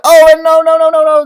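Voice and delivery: loud, high oh no no no; playful panic; mock alarm. Real, not acted. Iceberg breaking, alarmed.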